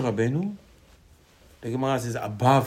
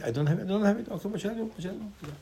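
higher, softer acted shrug; humble resignation; 6 semitones higher, 6 dB quieter, faster than usual. Teacher voices a humble person who never complains, resigned. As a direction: accept it lightly, voice lifted and dropping away.

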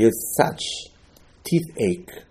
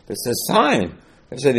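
ordinary talk

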